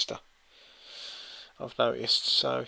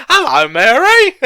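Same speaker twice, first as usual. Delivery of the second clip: laughing mock Cockney greeting, much higher and louder; 13 semitones higher, 13 dB louder than usual. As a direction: squeal it like a cartoon chimney sweep.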